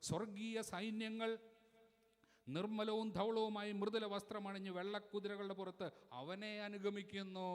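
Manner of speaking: high, loud preaching at full force; scripture proclamation. Acted. Preacher reads of heaven's armies to the congregation, high full-force proclamation. Proclaims next Bible verse.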